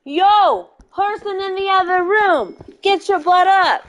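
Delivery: loud, high holler; calling out. Real, not acted. Waking the person in the other room, hollering. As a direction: yell it through to the next room.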